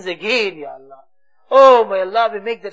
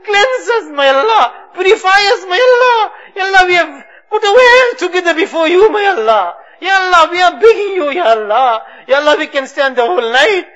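loud sobbing pleas, pitched higher; crying out; 5 semitones higher, 5 dB louder than usual. Real, not acted. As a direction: cry out to God through tears. Crescendo of weeping pleas.

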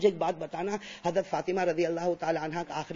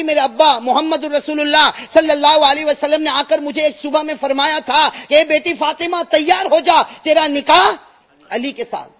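high, loud fervent sermon; impassioned preaching; 7 semitones higher, 11 dB louder than usual. Acted. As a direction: build it up and proclaim. Fatima's story preached with force. (بلند آواز میں پرجوش خطابت)

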